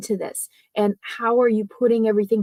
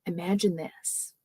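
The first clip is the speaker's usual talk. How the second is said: softer, drawing listeners in; hushed storyteller; 4 semitones lower, 8 dB quieter than usual. Shown as a demonstration. Coach showing a story opener to the audience, hushed. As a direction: hush it so they lean in. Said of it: "and you almost lean in".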